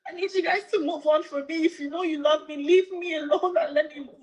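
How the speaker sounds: high-pitched, tearful begging; crying plea. Real, not acted. Woman pleading with her followers to leave her alone, crying. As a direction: beg to be left alone, really crying. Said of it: "laughing at Cora crying".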